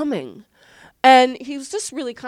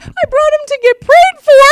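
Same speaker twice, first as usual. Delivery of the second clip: laughing through quote; 14 semitones higher, 11 dB louder than usual. Retold or imitated. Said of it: "and he goes".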